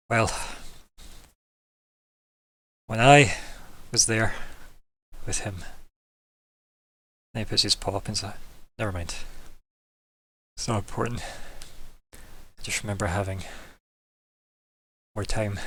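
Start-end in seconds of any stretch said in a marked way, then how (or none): 0.1-5.6 halting, acted breathless speech with long gaps; out of breath. Older Zig answers how early he is, acted out of breath. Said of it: "very out of breath". 8.8-15.6 winded, halting delivery with long pauses; breathing heavily, slowly. Acted. Older Zig brushes off the question, acted out of breath. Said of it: "breathes very heavily, very slowly".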